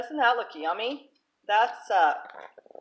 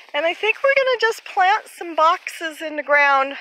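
ordinary talk, nothing marked

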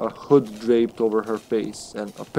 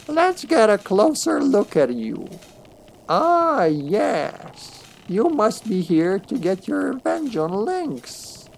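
high, slow knowing crone; 9 semitones higher, slower than usual. Acted. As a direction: savour the discovery like a witch. Crone inspects the thieves.

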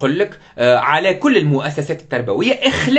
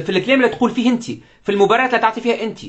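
fast indignant questions; 5 semitones higher, faster than usual. Real, not acted.

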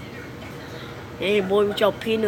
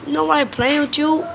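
ordinary delivery

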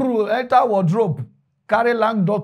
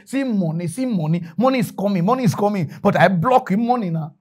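ordinary talk, nothing marked

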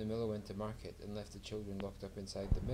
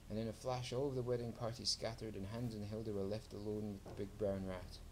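ordinary delivery, nothing marked

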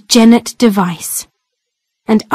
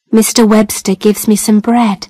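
ordinary delivery, nothing marked

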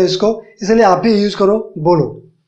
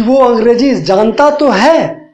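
slower, weight on "तो" in "जानता तो है"; emphatic stress; slower than usual. Shown as a demonstration. Teacher demonstrating an emphatic sentence to students. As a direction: say "जानता तो है" as if insisting. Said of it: "जब इसमें बात जोर दे के बोली जाए".

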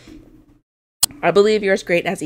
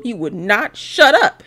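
ordinary talk, nothing marked

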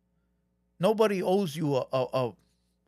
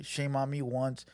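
lower, softer, slow mock quote; mocking Little Rob; 6 semitones lower, 7 dB quieter, slower than usual. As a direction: imitate him with a sneer. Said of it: "Little Rob does say that".

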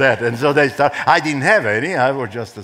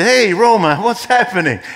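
higher, louder friendly hail; calling out; 6 semitones higher, 4 dB louder than usual. Retold or imitated. Lieutenant greets the pastor as he walks by, cheerful call.

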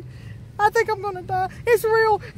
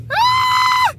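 long high, loud scream; sustained piercing shriek; frightened scream; 7 dB louder than usual. Real, not acted. Child telling daddy it came to see her, frightened scream.

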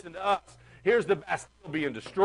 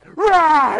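loud, high mock roar; a sudden imitated lion's roar; 11 semitones higher, 13 dB louder than usual. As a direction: roar like a lion at the glass. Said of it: "the lion just goes".

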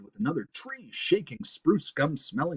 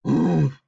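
loud moose grunt; great grunt; 6 semitones higher, 8 dB louder than usual. A narrator's reading. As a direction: grunt loudly like a big beast. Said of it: "they heard a great grunt".

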